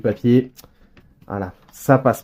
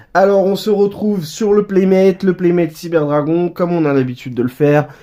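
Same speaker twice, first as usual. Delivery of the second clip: upbeat presenter; 7 semitones higher, 6 dB louder than usual. Acted. Opens the unboxing segment.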